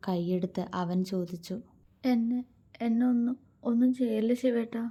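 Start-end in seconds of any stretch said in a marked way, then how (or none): 2.0-4.8 higher, halting, frightened plea; scared woman begging in broken phrases; fearful plea. A narrator's reading. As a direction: plead haltingly, tripping over the words. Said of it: "അവൾ പേടിയോടെ പറഞ്ഞു".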